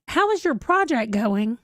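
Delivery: normal talking voice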